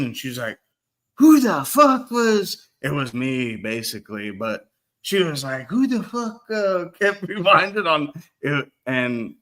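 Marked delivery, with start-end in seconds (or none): 1.2-2.7 high, loud mock-outraged mum; hammed-up mother demanding answers, slow; angry mum. 5.7-8.7 high, loud mock-outraged mum; angry mum.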